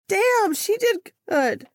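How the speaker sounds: high, slow and delighted; impressed by her own letter; proud. Real, not acted. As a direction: savor it with pride. Proud of her past self.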